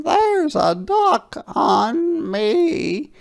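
very high, slow, loud hen; drawn-out falsetto chicken character. A narrator's reading. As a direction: squawk it out slowly as a hen. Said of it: "said the hen".

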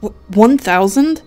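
loud, stammered shocked question; choked disbelief. Acted. Caller repeats the operator's demand, choking in disbelief. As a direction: stumble on the first word, aghast. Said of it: "I choked".

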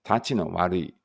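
ordinary delivery

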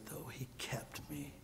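whispered testimony of being kept; hushed whisper. Real, not acted. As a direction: whisper it, awed and intimate.

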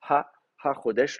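Ha in Ha Chodesh set apart and leaned on; hidden article shown. Shown as a demonstration. Teacher to class, showing the article Ha in Ha Chodesh. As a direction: point out the Ha of Ha Chodesh. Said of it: "un article défini qui se cache".

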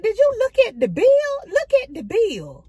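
scolding. Retold or imitated. Retold scolding of Kent.

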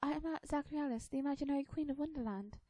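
put-on high, quick little-girl voice; proud young girl Alice. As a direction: announce yourself as a child playing queen. Alice grandly introduces herself.

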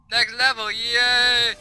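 loud, high celebratory call; cheering. Real, not acted. Player reaching the next level, cheering. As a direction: cheer it out, stretching the yay.

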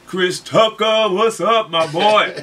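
high, loud, drawn-out holler to a buddy; shout-out. Real, not acted. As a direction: call out big and slow to a friend. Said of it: "I wanna shout out to Chris Tucker".

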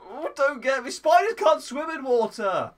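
loud, slow alarmed insistence; frantic protest. Real, not acted. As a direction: raise the voice in alarm. Panicking about spiders in water.

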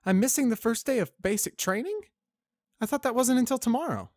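put-on high, loud startled young mare; young cadet. Spitfire voiced in story.

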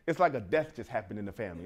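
ordinary talk

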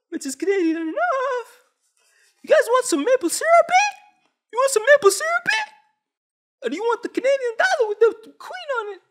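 very high, loud squeaky falsetto; mock Canadian host. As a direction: parody an over-polite Canadian show host in falsetto. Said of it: "what it gonna look like when they pass that bill".

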